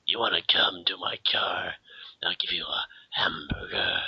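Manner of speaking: whispered creepy stranger voice. Pretend. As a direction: hiss it like a sketchy van guy. Creepy stranger offering a ride.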